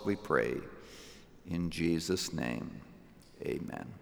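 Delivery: earnest pastoral prayer, softening to a hushed amen; closing prayer. Acted.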